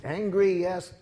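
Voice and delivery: ordinary talk, nothing marked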